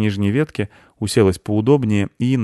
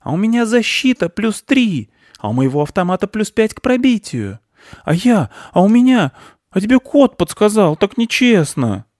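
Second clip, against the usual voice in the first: put-on high, loud kid's protest; squabbling boy; 13 semitones higher, 5 dB louder than usual. Boy disputes who won the game, high and heated. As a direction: argue in a high-pitched boy voice.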